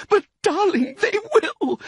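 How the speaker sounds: very high, tearful wail; sobbing. A narrator's reading. Wife pleading with her darling, sobbing. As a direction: plead through sobs, pitched very high. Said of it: "sobbed Missus Fox".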